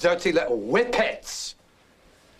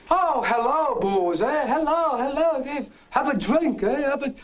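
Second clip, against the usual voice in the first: high, loud, falsely cheery welcome; mock jovial greeting; 11 semitones higher, 4 dB louder than usual. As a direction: ham up the guy's nervous cheer. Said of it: "And he says".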